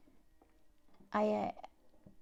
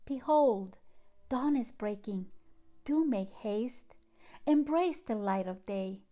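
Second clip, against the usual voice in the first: louder, declaimed poetry reading with long pauses; dramatic reading of her own poem, louder throughout; poem recital; 5 dB louder than usual. Acted. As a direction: proclaim each line like a sunrise call to the heart. Reads her poem aloud.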